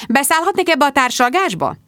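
high, indignant polite question; bristling. A narrator's reading. Woman demanding a part in the conversation, bristling. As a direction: ask to join in, pitched well up and affronted. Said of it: "borzoltam a sertéimet".

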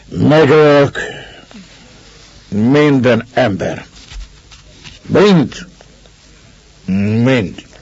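put-on slow, slurring drunk menace; drunken threat. A narrator's reading. Drunk Ivan sitting up, threatening everyone, drunken threat.

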